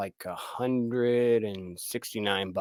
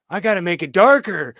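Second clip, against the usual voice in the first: mock frustrated shout; 7 semitones higher, 9 dB louder than usual. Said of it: "I'm like".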